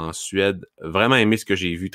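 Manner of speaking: ordinary delivery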